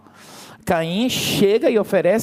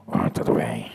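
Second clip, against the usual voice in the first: sulky reply; 4 dB quieter than usual. Pretend. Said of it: "Tá tudo bem".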